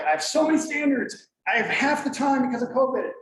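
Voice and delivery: acted-out protest, high and loud; exasperated complaint. Acts out teachers' usual complaint.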